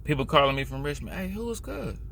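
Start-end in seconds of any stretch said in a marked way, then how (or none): 1.1-2.0 high, soft mock caller; soft high-pitched imitation of someone asking; imitated caller. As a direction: mimic a puzzled caller, high and hushed. Mimics callers asking about the dude.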